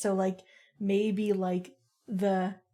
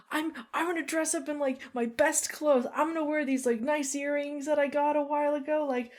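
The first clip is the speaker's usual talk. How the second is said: high, quick, dreamy chatter; super excited friend; 6 semitones higher, faster than usual. Acted. Amethyst plans an outfit for the party, excited. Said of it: "Amethyst is super excited".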